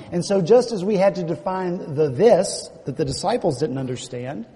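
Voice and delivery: ordinary delivery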